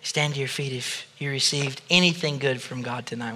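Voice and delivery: ordinary talk, nothing marked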